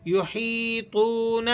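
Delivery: یحیطون with the ya stretched; slow یحیطون; long ya. Shown as a demonstration. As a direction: draw out the hee of یحیطون. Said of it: "کھینچ کر پڑھا جائے گا".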